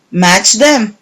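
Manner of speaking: ordinary delivery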